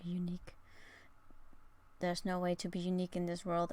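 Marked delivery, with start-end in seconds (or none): 0.0-0.5 soft unvoiced whisper. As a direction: breathe it out secretly. Whispered reading of chat advice.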